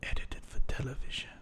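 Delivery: hushed, dark European-accented whisper. Shown as a demonstration. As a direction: breathe it out darkly. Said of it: "but you said it so dark".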